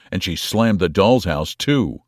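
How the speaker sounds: ordinary delivery, nothing marked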